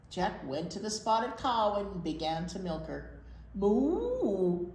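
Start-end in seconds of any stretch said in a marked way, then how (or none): 3.5-4.7 louder mooing cow. Acted. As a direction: moo like a cow. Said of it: "said the spotted cow".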